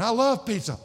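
enthusiastic. Retold or imitated. Relives his love of pizza.

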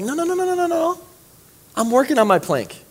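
high, mock defensive protest. Pastor mocking churchgoers dodging the rebuke, high mock protest. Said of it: "And we're like".

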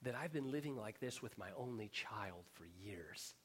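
whispered, weary father. Acted. Preacher voicing the worn-out father, whispering. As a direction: breathe it out like a tired parent.

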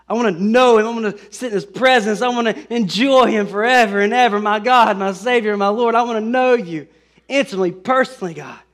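fervent prayer. Acted. Voiced heartfelt prayer of longing.

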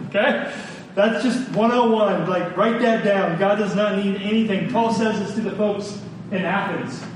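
usual speaking voice

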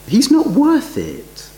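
mock scornful disciples. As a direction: repeat the grumblers' contempt, pressing harder. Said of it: "effectively they are saying".

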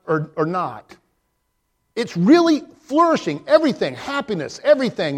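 high, loud, impassioned preaching; fervent preaching. Acted. Pastor preaching the psalm to his congregation, impassioned. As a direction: preach it with rising fire.

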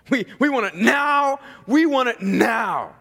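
high, mock-impatient demand; sarcastic demand. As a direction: act the impatient customer, hammering out each word. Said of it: "I'm being sarcastic".

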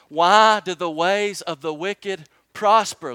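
loud, slow, pleading plea to God; anguished cry to heaven. Retold or imitated. Jeremiah calling out to the Lord in loud anguish.

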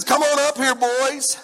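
loud, high holler; calling out. Acted. King calls the boys up out of the fire, hollering. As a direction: call them up with a big high shout.

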